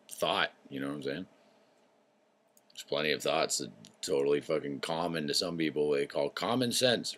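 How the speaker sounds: ordinary delivery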